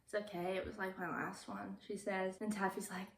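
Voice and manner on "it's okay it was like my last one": unenthusiastic whisper. Retold or imitated. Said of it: "very unenthusiastic, does not care".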